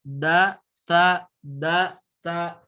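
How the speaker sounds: "Da, ta" from the same spot, tongue at the gums; da and ta alike. Shown as a demonstration. Teacher comparing da and ta, crisp drill. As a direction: alternate "Da" and "ta" crisply. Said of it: "satu makhraj dengan ta".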